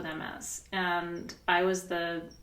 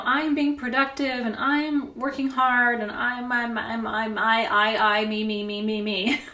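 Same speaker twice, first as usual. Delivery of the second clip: mock self-pity; 3 semitones higher, 6 dB louder than usual. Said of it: "you know".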